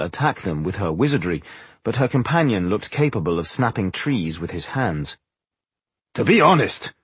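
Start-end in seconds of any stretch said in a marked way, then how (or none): 6.1-6.9 higher-pitched, forthright opening; blunt. A narrator's reading. Boindil to the visitor, not beating around the bush, blunt. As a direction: speak up bluntly, pitched high. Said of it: "decided not to beat around the bush".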